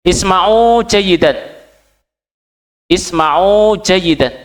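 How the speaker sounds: slow classroom call to attention; teacher's command. Shown as a demonstration. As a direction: announce it to the class, slow and clear. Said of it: "Dengarkan dengan baik".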